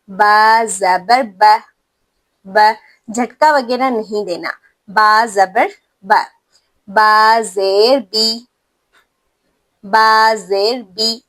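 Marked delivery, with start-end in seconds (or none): 0.1-2.6 ب said slowly, short a, no jolt; slow spelled recitation. Shown as a demonstration. Teacher spelling out ba with zabar for students, slow. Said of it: "جیسے". 4.9-11.2 بی said slowly with a clear ee sound; slow spelled recitation. Shown as a demonstration. Teacher spelling ba with zer, slow, clear ee. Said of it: "ای کی آواز یہاں پر آپ نے نکالنی ہے".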